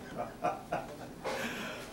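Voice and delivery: soft madman laugh. Acted.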